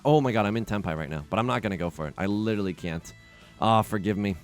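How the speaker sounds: normal talking voice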